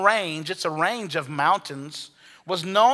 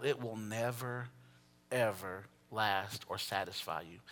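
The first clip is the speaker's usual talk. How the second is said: hushed warning; 9 semitones lower, 16 dB quieter than usual. Real, not acted. Solemn quiet warning.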